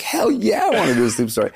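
retold burst of enthusiasm, pitched high; eager yes. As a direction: leap up high on an excited yes.

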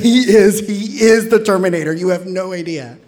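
high, loud, urgent insistence; emphatic agreement. Retold or imitated. Teenager agreeing with friends about his scary dad, emphatic.

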